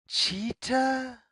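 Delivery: plain reading voice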